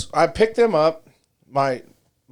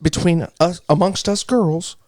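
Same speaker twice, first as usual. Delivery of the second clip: ordinary delivery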